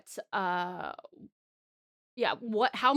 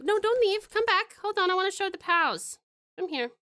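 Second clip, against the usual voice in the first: calling the dog; 10 semitones higher, 5 dB louder than usual. Real, not acted.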